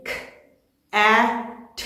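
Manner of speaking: "ख, ए, ठ" as three isolated sounds, long pauses between; teacher segmenting "ख, ए, ठ" sound by sound; word broken into sounds. Shown as a demonstration. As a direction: sound out cat as "ख", "ए", "ठ". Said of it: "sounds को तोड़ेंगे".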